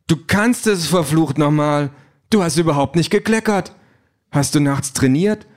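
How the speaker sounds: high, amazed outburst; astonished cry at a full cup of coffee; astonished exclaim. A narrator's reading. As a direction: cry out in disbelief. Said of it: "Dann ruft sie erstaunt".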